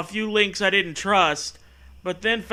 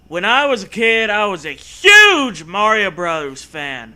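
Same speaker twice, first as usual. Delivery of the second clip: mock dramatic narration; 3 semitones higher, 8 dB louder than usual. Mock dramatic story reading opening.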